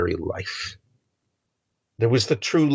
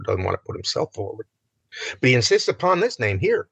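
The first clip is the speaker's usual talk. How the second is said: ordinary delivery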